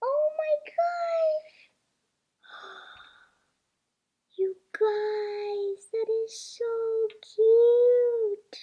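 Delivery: high, slow gushing over something cute; gushing squeal. Real, not acted. Seeing the hatched toy, high slow cute gush. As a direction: melt over how cute it is.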